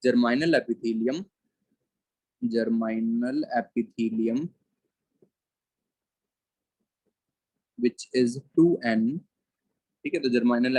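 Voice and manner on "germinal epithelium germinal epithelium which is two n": very slow, word by word; slow dictating voice; dictation pace. Shown as a demonstration. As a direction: read it out slowly for notes. Dictating notes to class.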